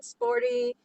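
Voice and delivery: ordinary talk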